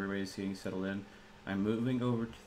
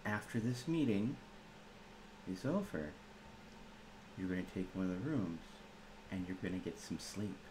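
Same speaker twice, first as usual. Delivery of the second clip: soft-spoken threat; 7 dB quieter than usual. Acted. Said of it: "I've never heard sleep sound so threatening before".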